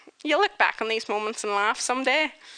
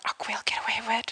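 whisper; 5 dB quieter, much faster than usual. Retold or imitated. Scheming about skirts.

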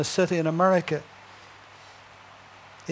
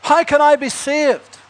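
high, loud, slow desperate call; man running beside the train calls out; shouted question; 7 semitones higher, 6 dB louder than usual. Acted. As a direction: shout it after a departing train. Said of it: "he shouted at Moody".

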